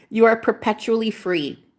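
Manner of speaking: ordinary talk, nothing marked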